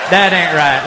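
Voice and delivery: ordinary talk, nothing marked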